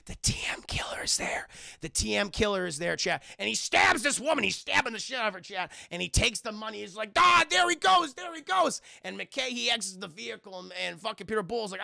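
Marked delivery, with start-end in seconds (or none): 0.1-1.5 dramatic whisper, low; hushed reveal. Retold or imitated. Telling chat the killer has turned up, hushed reveal. 7.2-8.8 high, loud calling out; mock alarmed shout. Imitating a cop spotting the fleeing killer, mock alarmed shout.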